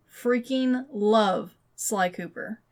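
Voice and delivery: ordinary talk, nothing marked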